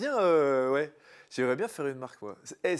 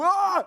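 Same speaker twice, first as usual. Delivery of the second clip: sudden yell; 8 dB louder than usual. Shown as a demonstration. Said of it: "tu cries d'un coup".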